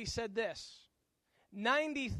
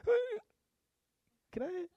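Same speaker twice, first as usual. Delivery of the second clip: put-on high, squeaky kid voice; attempted child's whine; 10 semitones higher than usual. Kid trying to whine with a smile, high faltering whine. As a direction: try a high whine that cannot quite get out. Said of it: "Your kids whine".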